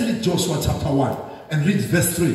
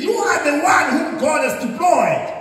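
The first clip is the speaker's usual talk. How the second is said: proclaiming shout; 8 semitones higher than usual. Acted. Preacher proclaiming the campus's call.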